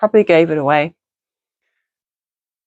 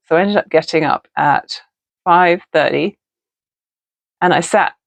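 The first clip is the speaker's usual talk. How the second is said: retainer in mouth; wearing a retainer. Real, not acted. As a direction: talk around a clear retainer. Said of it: "talking to you with my retainers in".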